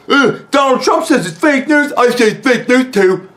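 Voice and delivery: loud, high mock-dopey parroting; mocking impression. Vlogger parroting an annoying commenter about fake news, loud mocking voice. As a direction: shout it back like a mindless parrot. Mocking an online opponent's posts.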